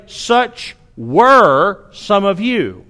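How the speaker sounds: very slow, weighty reading with "were" stressed; slow older verse reading. Shown as a demonstration. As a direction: read the old wording slowly, word by word. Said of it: "Some of the older versions say".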